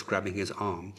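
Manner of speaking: usual speaking voice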